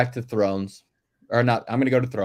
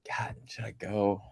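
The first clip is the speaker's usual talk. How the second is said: a breathy whispered aside, slower; 9 dB quieter, slower than usual. Real, not acted. Podcaster hesitating over his pick, whispered aside. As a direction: mutter it under your breath to yourself. Thinking aloud to himself.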